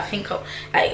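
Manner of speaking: breathy whisper, hesitant; quiet. Shown as a demonstration. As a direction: whisper it shyly, unsure of yourself. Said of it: "a bit quiet".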